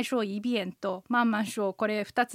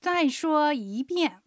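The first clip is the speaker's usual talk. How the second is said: slow, syllable by syllable, slightly higher; slow practice take; 4 semitones higher, much slower than usual. Shown as a demonstration. Repeating the phrase in practice, slowly and carefully. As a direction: draw out every tone clearly.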